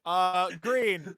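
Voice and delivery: playful fake answer; mock answer. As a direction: say it like a cheeky lie. Joke answer to the question.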